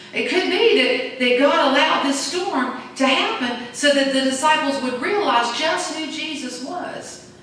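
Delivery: normal talking voice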